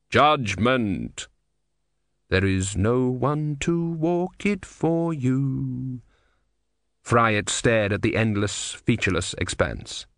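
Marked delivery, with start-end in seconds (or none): 0.1-6.0 grave and measured, like Death. A narrator's reading. Death speaks.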